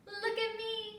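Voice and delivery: put-on high, bright child call. A narrator's reading. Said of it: "she called".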